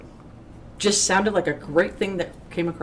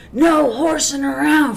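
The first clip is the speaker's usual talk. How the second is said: called-out warning; 8 semitones higher, 5 dB louder, slower than usual. Retold or imitated. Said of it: "Next thing I hear is".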